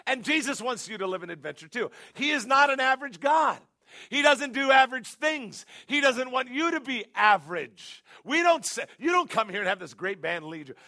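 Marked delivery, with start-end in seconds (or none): 2.1-8.0 louder, higher pulpit declaration; preaching at full force. Real, not acted. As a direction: proclaim it boldly.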